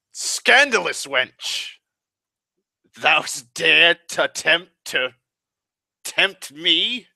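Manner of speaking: put-on slow, theatrical old-English declamation; hammed-up Shakespearean. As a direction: overplay it like a stage actor in tights. Hammed-up old-English line reading.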